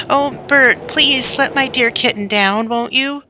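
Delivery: put-on high, anxious boy's plea; pleading. Little boy begging big brother Bert, high pleading. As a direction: beg big brother desperately.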